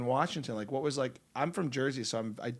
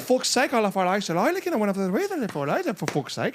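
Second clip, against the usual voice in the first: higher, louder Irish voice; Irish accent; 7 semitones higher, 7 dB louder than usual. Shown as a demonstration. Actor riffs in Irish accent for a friend. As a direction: launch into an Irish lilt. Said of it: "Irish friend who literally says that".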